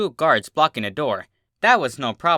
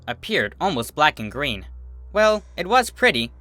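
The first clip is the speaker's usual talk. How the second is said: ordinary delivery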